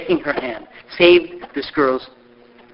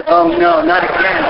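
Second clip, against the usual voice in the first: mock dismay; 12 semitones higher, 3 dB louder, slower than usual. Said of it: "retold own reaction".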